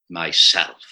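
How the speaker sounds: low, solemn "Myself"; a deep voice that makes the earth shake. A narrator's reading. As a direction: drop the voice right down, slow and grave. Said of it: "very deep and low".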